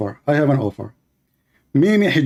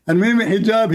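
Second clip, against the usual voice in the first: ordinary talk, nothing marked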